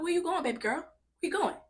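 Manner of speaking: high, loud re-enacted call after someone leaving; mock alarmed call. Calling after nurse leaving, retold.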